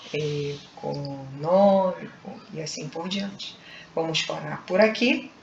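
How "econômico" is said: "econômico" drawn out in separate syllables; slow syllable-by-syllable. Shown as a demonstration. Teacher ending the polysyllable examples, slowly syllable by syllable. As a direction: sound out "econômico" slowly, part by part. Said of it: "quando pronunciamos lentamente".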